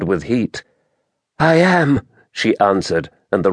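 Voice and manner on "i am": high-pitched character voice for a weary woman; wounded woman. A narrator's reading. Injured Merinda answers Taras, high wounded woman's voice.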